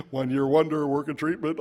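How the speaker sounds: usual speaking voice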